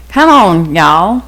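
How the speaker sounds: lightly hammed-up Louisiana drawl; put-on Louisiana accent. Shown as a demonstration. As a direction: sound like you're from Louisiana, y'all. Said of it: "I could sound like I'm from Louisiana".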